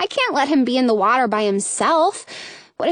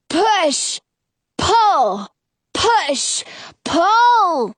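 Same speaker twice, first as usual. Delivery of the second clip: barked orders; 5 semitones higher, much slower than usual. Acted. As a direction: call out each stroke like a coxswain. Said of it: "I order".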